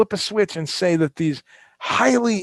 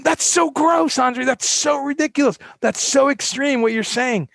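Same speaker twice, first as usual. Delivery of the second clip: high mock-disgusted whine; mocking listener; 10 semitones higher than usual. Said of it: "you're probably going".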